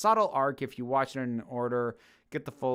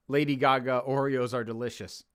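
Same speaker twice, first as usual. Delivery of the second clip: normal talking voice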